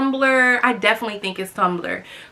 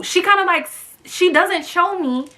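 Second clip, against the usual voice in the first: ordinary talk, nothing marked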